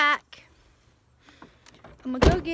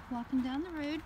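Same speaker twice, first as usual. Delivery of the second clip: ordinary talk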